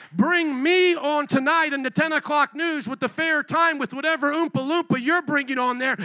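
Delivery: loud, fiery preaching; rally speech. Acted. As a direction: preach it full force to the crowd. Preaching at full force, rally peak.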